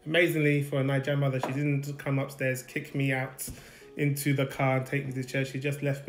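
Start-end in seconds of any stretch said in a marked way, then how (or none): none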